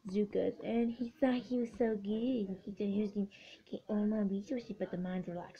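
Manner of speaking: normal talking voice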